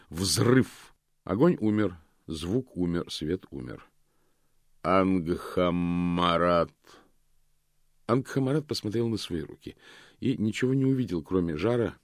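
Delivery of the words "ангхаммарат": slow, hollow calling voice. A narrator's reading. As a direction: call the name slowly, echoing. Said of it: "повторил гулкий голос". (гулкий медленный голос)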